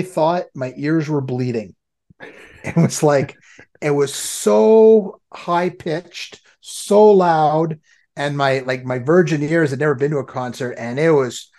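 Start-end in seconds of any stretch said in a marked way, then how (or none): 4.1-7.8 stretched, loud emphasis on each SO; drawn-out emphasis. Real, not acted. Friend recalling his deafening first concert, drawn-out emphasis. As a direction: lean hard and long on SO, louder and higher.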